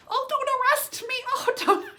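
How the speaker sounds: put-on very high, pleading little old lady; scared Grammy voice. Imitating Grammy pleading with the police, high and loud. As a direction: squeak it out like a tiny grandma begging the cops.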